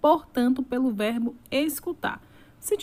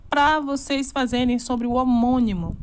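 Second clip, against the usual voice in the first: ordinary talk, nothing marked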